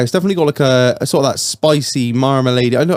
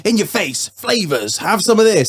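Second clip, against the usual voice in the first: punchy, higher-pitched mock London pitch; brash London flavours; 6 semitones higher, slower than usual. Podcaster imitating in-your-face southern beers, brash London pitch. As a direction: shove each flavour at them with bouncy pitch. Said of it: "sort of more London way".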